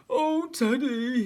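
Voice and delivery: loud, slow, high wail; whimpering bear. A narrator's reading. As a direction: whimper it big and drawn-out. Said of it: "he whimpered".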